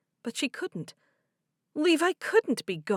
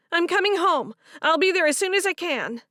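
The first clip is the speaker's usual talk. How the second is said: put-on urgent young woman voice; 10 semitones higher, 7 dB louder than usual. Favor voiced in story.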